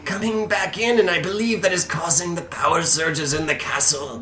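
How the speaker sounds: high-pitched elder explaining a danger; an old keeper's high, halting warning; wizened old man. Acted. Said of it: "this wizened old man".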